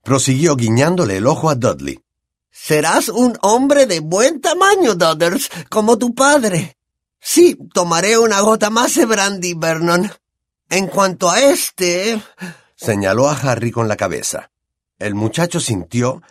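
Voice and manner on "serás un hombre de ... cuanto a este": high, brash, boozy aunt voice; Aunt Marge. Acted. Aunt sneers at the family over brandy, high brash voice.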